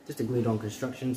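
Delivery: usual speaking voice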